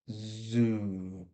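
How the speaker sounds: zoo with a long buzzing z and long oo; exaggerated sound. Shown as a demonstration. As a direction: stretch out zoo to show the z. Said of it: "I'm exaggerating there".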